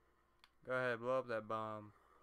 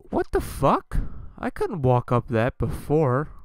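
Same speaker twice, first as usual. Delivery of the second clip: shocked outburst; 2 semitones higher, 16 dB louder than usual. Real, not acted. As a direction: shout out in sudden bafflement. Sudden shock at the game.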